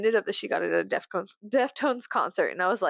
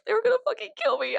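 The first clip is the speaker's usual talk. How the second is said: high, fast frightened imitation; mock panic; 11 semitones higher, faster than usual. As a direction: imitate a man in a panic, pitched way up. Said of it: "He was scared for his life".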